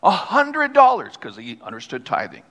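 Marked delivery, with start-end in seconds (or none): 0.0-1.1 high, slow, louder call from the pews; yelled answer. Retold or imitated. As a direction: call it out like an eager churchgoer. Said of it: "Somebody would yell out".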